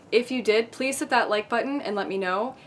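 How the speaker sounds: ordinary delivery, nothing marked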